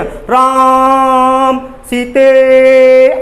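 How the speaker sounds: "राम सीते" with each vowel drawn out very long and loud; pluta long vowels. Shown as a demonstration. Teacher demonstrating pluta, calling "राम सीते" with long held vowels. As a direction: call "राम सीते" across a distance, holding the vowels. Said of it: "आ की ध्वनि को कितना लंबा लिया".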